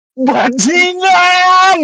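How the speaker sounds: high, drawn-out yell of a curse; retold frustrated yell. Worn out after the fifth room, frustrated yell. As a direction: let a long, high-pitched yell rip out. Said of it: "pengen teriak aku".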